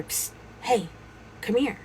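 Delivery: hushed psst, then beckon; furtive beckoning. Retold or imitated. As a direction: get attention on the sly. Hidden figure beckons from under trailer.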